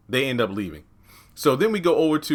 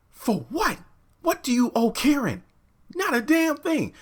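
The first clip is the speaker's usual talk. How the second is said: exasperated. Real, not acted. Exasperated rant at character.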